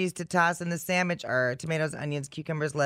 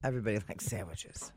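soft unvoiced whisper; 6 semitones lower, 9 dB quieter, faster than usual. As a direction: whisper it like a secret. Mock whispered answer.

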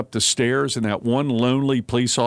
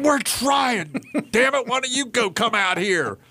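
much higher exasperated mock rant; mock yelling back; 11 semitones higher than usual. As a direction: play the fed-up lineman, hammed up. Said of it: "yell back at the person".

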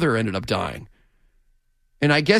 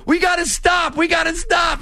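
mock-panicked ref yelling to halt the show; mock ref shout. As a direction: play a frantic referee begging to stop.

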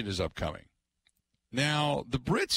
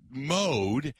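normal talking voice

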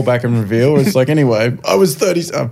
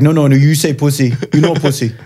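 put-on higher, protesting Indian-accented voice; imitated Indian accent; 4 semitones higher than usual. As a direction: mimic an indignant Indian takeaway man. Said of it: "stuck in the accent too".

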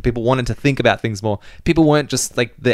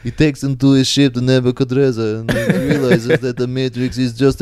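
put-on Russian accent. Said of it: "in his Russian accent".

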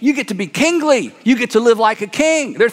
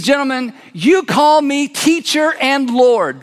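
slow solemn; 3 semitones higher, slower than usual. Acted. Jesus addresses the twelve.

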